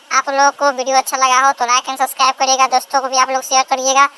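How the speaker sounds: very high-pitched, fast character voice; sign-off in character. Acted. Asking viewers to like and share, fast character sign-off. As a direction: rattle off the outro in a high put-on voice.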